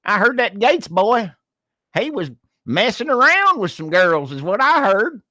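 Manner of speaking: high, chatty mock hillbilly gossip. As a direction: gossip like a country neighbor. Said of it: "redneck gossip bit".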